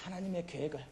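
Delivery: 하나님의 계획을 said again in a hushed, settling voice; soft echo. Acted. Preacher echoes key phrase softly.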